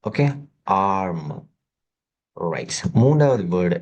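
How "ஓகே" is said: ordinary talk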